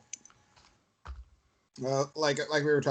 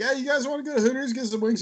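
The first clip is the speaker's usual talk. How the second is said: mock eager invite; 11 semitones higher, faster than usual. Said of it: "You're all like".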